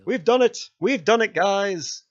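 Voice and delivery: high, loud victory cheer; triumphant. Real, not acted. Player telling viewers of the win, elated and high. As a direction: celebrate the win with your audience.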